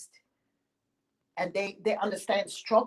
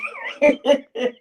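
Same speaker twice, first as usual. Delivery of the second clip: high, breathy giggle breaking through; 8 dB louder than usual. Real, not acted. Teller cracking up mid-tale with a high giggle. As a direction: giggle at your own story.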